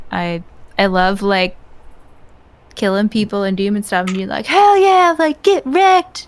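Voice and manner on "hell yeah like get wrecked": loud, very high gamer whoop, stretched out at the end; gleeful trash talk. Acted. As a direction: crow over a kill in a high, raised voice. Acting out gleeful gaming trash talk.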